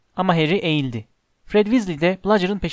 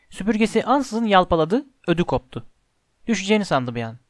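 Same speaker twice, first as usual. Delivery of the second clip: ordinary delivery, nothing marked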